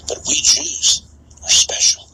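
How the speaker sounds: mock whisper. Mocking aside within voiced quote.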